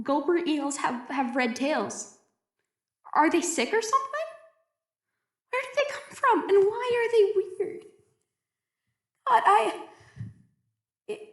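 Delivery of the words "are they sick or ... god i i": high, nervous, with a shaky laugh; frightened questions. Acted. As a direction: near panic, asking the dark. Frightened questions about strange eels.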